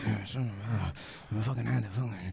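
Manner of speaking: mock him mumbling low and soft, dragging it out; quiet intense. Cohost mimicking Steve reliving sex, quiet. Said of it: "He gets quiet".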